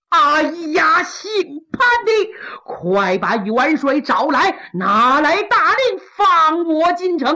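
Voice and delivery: loud, slowed, spaced-out commands; stern order. Acted. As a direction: lay down each demand slowly and hard. Qilang orders Pan Hu angrily. (愤怒地一字一顿下令)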